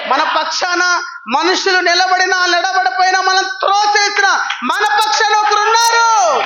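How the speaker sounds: loud fervent preaching; preaching shout. Real, not acted. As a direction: declare it with full fire. Preaching at full force.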